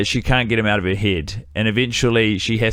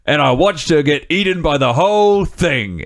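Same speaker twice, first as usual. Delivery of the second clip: high, overacted British storyteller; bad British actor; 4 semitones higher than usual. Podcaster retells islander's shark story, hammed-up British actor voice. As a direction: ham up the shark tale, pitched up. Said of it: "he's the worst actor I've seen".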